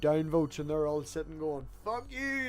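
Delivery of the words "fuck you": high, soft mock outrage; put-on high voice for petty haters; mock angry downvoters. Host mimics downvoters cursing the video, mocking high voice.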